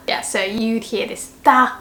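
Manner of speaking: ordinary talk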